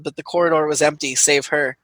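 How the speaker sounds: ordinary talk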